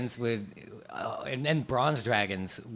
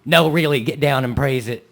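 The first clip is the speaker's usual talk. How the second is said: Texas preacher; 3 dB louder than usual. Acted. Texas preacher bit continues.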